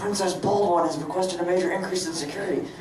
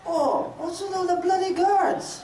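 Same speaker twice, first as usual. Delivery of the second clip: character voice; 12 semitones higher, slower than usual. Acted. Character voice, shifted high.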